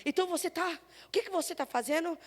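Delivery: usual speaking voice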